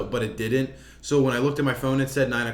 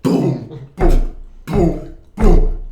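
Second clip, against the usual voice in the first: imitated drum booms; 7 dB louder, much slower than usual. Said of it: "it's just like".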